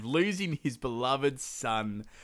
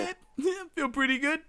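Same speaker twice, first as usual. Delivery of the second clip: much higher, breezy self-satisfied voice; smug content Rama; 13 semitones higher, faster than usual. Retold or imitated. Rama after giving up the throne, high breezy smugness. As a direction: sound pleased with yourself. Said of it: "He's like".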